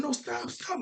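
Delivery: high, mock-panicked plea; half-voiced panic, pitched well up; imitated freak-out. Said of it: "she started freaking out".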